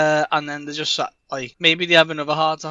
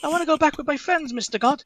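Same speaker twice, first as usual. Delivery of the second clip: a touch higher, meek request; lightly voiced pleading Pim; 11 semitones higher than usual. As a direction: ask God sheepishly.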